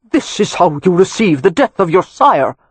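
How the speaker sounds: put-on loud, indignant old earl; demanding. A narrator's reading. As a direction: demand it with outraged pride. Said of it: "Cavan demanded".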